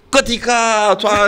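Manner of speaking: drawn-out, high-pitched mocking call; teasing group chorus. Band members teasing him with his pet word, mocking drawn-out chant. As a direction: stretch it high, mimicking friends teasing in unison. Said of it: "itu anak-anak [name] berempat pada".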